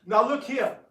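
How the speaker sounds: sharp, loud rebuke; indignant loud scolding; indignant outburst. Acted. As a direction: call him to order, loud and high. Angry objection to snorting.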